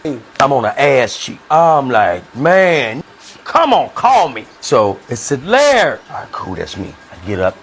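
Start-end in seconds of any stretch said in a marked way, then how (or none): 2.4-4.5 high, drawn-out pleading; exasperated impatience. Acted. Patient waiting on one cheek at sick call, exasperated. 5.4-6.0 high, loud name call. Acted. Clinic staff summon the next patient, calling out loudly.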